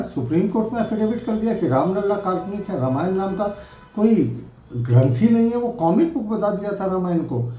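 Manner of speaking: rising indignation, hammering the accusation home; indignant outrage. Real, not acted. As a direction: spokesman firing back at Akhilesh, pitch climbing in outrage. Politician's indignant rebuttal.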